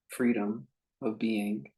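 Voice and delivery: ordinary talk, nothing marked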